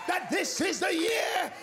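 high-pitched, shouted proclamation; full-force prophetic preaching. Real, not acted. Preacher prophesying breakthrough to the congregation, full-force shouted preaching. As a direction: cry out the prophecy over the crowd.

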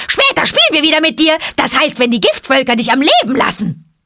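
high, loud, fast mocking cry; taunting monkey shout. A narrator's reading. Monkeys jeer at Mowgli, high and loud.

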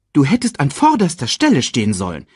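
high, reproachful herald voice; scolding. A narrator's reading. Sasu reproves the king's brother, high scolding. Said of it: "tadelte ihn Sasu".

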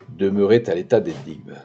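usual speaking voice

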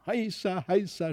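high, restrained cheer; mild mock yell, soft and high; a light boyish hurrah. A narrator's reading. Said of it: "schrie Felix unaufhörlich".

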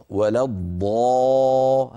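a slow drawn-out emphatic ض; heavy emphatic dad. Shown as a demonstration. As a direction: stretch the emphatic ض slowly. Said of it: "هذه الضاد أفخم".

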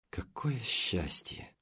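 slow, soft, contented sigh; blissful sigh. A narrator's reading. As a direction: sink into bliss, slowly. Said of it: "с довольным видом вздохнула она".

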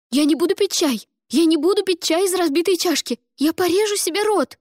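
high, loud shrieking refusal; hysterical refusal. Acted. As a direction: shout it out hysterically. Ada refuses tea hysterically.

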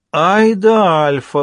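doctor cries out in triumph, loud and drawn out; excited exclamation. Acted. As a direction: shout it out in sudden realisation. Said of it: "вскричал доктор Майк".

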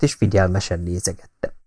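ordinary delivery

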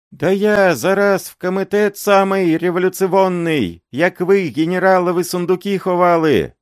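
high, loud desperate pleading; old man wailing. A narrator's reading. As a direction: beg and wail, voice up high. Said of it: "этот воющий голос".

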